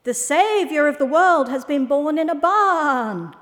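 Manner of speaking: high, loud, breaking news; excited sheep. A narrator's reading. Sheep announcing the birth to owl, excitedly. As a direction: proclaim it as a thrilled sheep. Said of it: "dozens of excited sheep".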